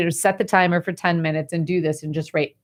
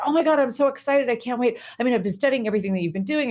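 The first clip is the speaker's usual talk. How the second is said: higher, fast and gushing; excited young monk; 6 semitones higher, faster than usual. Acted. Young monk gushing at the old monk's door, excited and fast. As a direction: bubble over like an eager apprentice. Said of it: "he gets so excited".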